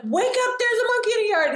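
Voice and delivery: high-pitched, urgent retelling of waking someone; urgent wake-up call. Retold or imitated. Waking brother in panic.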